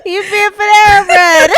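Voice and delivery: laughing. Real, not acted. Joke delivered while laughing.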